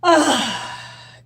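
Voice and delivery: big loud sighing breath out; loud exhale. Shown as a demonstration. Coach showing viewers the release breath, loud ah. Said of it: "three big breaths".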